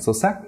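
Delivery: Zosak with the pitch sweeping up; rising tone. Shown as a demonstration. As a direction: let the pitch of Zosak climb high. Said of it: "goes up".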